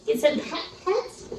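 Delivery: soft whisper to the dog; whispered pet talk. Real, not acted. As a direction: whisper sweetly to the puppy. Cooing to dog.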